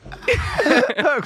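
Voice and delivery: a sudden high burst of laughter. Real, not acted. Hearing the video. (højt udbrud af latter)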